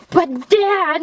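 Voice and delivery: high, put-on protest; hammed-up whiny plea to a father; mock pleading son. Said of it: "his cousin is like".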